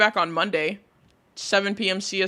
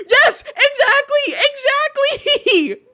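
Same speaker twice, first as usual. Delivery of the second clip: squealing laugh; 6 dB louder than usual. Real, not acted. High squeal agreeing with chat.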